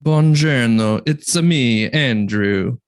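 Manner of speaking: playful Italian American greeting; Brooklyn Italian accent. Pretend. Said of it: "the West Coast boy doing a, uh, Brooklyn Italian accent".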